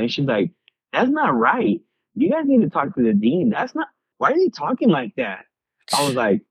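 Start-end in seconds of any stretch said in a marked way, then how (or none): 0.9-5.4 higher-pitched imitation of an outraged wife; indignant wife. Wife objects to professor yelling at student, mock indignant. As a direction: protest on someone's behalf, voice pitched up.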